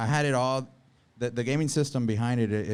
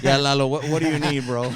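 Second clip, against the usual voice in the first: put-on playful Indian accent, pitched up and louder; 3 semitones higher, 6 dB louder than usual. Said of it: "me and my Indian accent".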